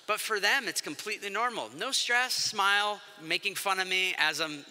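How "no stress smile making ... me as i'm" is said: normal talking voice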